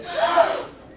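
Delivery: high, loud, laughing gasp; mock gasp. Wolf reliving hearing God loves him, laughing gasp. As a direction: gasp as if stunned. Wolf reenacts his shock.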